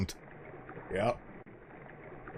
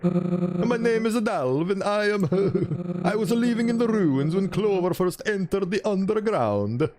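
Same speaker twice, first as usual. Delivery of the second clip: timid Dalv; 7 semitones higher than usual. Acted. Dalv introducing himself to the gang, higher and hesitant. Voices a new character.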